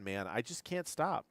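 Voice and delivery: ordinary talk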